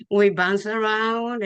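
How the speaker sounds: usual speaking voice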